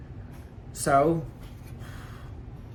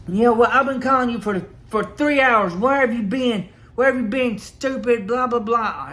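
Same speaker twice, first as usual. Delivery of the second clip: higher and louder, mimicking her furious yelling; angry yelling; 7 semitones higher, 4 dB louder than usual. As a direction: shout it like the boss calling you lazy. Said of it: "Like, she was mad".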